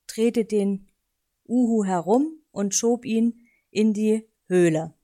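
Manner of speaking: ordinary delivery, nothing marked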